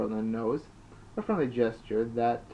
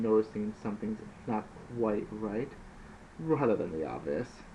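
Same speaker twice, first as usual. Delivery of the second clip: normal talking voice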